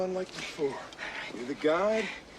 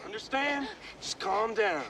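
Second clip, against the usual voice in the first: stern raised voice telling her to calm down; forceful calming; 13 semitones higher than usual. Acted. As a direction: shout her down until she calms. Restraining a panicking woman.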